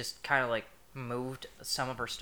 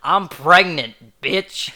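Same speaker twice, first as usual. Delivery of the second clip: loud, drawn-out imitation of a woman yelling; 4 semitones higher, 15 dB louder, much slower than usual. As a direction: belt it out like her street outburst. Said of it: "and yelling".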